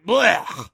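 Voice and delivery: loud retching noise of disgust; exaggerated gagging "blech". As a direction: burst out a big grossed-out blech. Creature disgusted by their smell.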